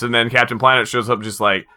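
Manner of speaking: ordinary delivery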